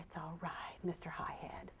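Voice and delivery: soothing whisper. Real, not acted. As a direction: hush him gently down. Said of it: "I'm just loving him here".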